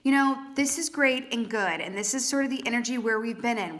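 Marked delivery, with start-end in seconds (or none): none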